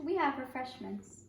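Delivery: ordinary delivery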